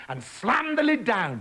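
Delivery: ordinary delivery, nothing marked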